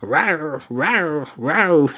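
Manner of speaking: mock lion rawr, lightly growled; lion roar noise. Barman imitates lion for dispatcher, mock rawr. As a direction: mimic a lion going rawr. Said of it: "all he can do is go".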